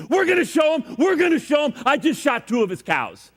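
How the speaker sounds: very high, worked-up mock fury, easing at the end; hammed-up rage. Friend boasts of shooting cows, hammed-up angry shouting. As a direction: rant red-faced, pitch shooting up. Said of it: "he's all angry, and he's red-faced".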